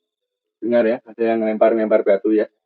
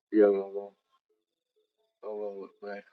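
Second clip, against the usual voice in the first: much softer, breathy Allahu Akbar; whispered prayer; 8 dB quieter than usual. Real, not acted. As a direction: mutter it under your breath in fear. Frightened prayer after noises.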